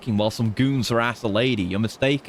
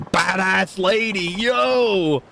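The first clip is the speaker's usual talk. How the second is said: higher, drawn-out hype shout; hyped cheer; 6 semitones higher, much slower than usual. Real, not acted. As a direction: cheer her on like a fan. Cheering on a tough woman.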